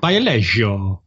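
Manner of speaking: put-on slow Italian accent, Bio Lego as LEH-jo; drawn-out Italian Bio Lego. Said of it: "I was trying to read it in an Italian accent".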